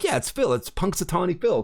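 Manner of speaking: retold eager know-it-all reply; eager answer. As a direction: toss it off brightly like you just remembered. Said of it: "And we're like".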